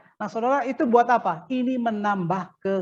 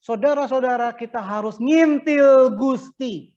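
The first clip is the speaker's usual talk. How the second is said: slow, loud, raised sermon call; Javanese-worded exhortation; 5 semitones higher, 6 dB louder, slower than usual. Shown as a demonstration. Preacher showing his Javanese wording to the class, slow emphatic exhortation. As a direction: draw out NGINTIL and GUSTI, loud and high. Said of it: "Kalau bahasa daerah saya Jawanya".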